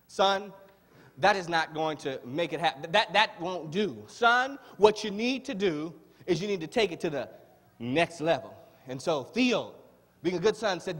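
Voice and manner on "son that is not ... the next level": normal talking voice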